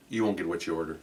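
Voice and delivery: low, very soft aside; imitating a hushed, low warning; confidential warning. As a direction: slip a quiet tip to a stranger. Stranger quietly warns him about waitress.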